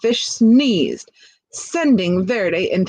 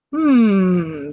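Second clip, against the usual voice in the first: hum. Acted. Old fish spots the snake, thoughtful hungry hum. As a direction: hum while eyeing the snake. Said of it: "the old fish hummed".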